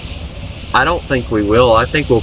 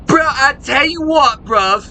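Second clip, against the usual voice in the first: high, hammed-up British-Australian accent; mock regional accent; 14 semitones higher than usual. Streamer imitating other parts of Australia, high mock accent. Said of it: "they sound like".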